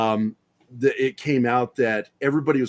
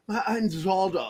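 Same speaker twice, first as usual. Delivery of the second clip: higher, mock pompous English voice; pompous English Zardoz; 7 semitones higher than usual. Showing his co-host a pompous English Zardoz. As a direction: ham up a grand English Zardoz. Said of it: "do the voice, man".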